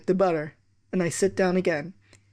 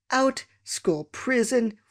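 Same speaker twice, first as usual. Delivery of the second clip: stern slow sentence; 5 semitones higher, much slower than usual. Retold or imitated. Teacher sentencing pupil.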